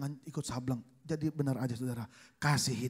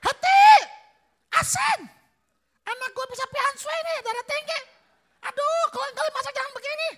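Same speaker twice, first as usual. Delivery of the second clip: shrill, much higher, loud scolding shout; outraged mother yelling about salty food; mother-in-law shrieking; 13 dB louder than usual. Retold or imitated. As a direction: screech at the daughter-in-law about the salt. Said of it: "Mama saya makan".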